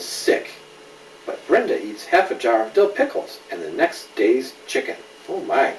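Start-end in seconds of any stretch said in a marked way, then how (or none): none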